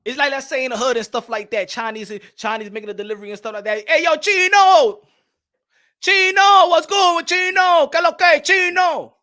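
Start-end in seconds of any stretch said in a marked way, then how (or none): 3.9-9.2 loud, high street holler, names drawn out; calling out. Acted. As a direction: holler across the block as a boisterous greeting. Calling out to delivery man.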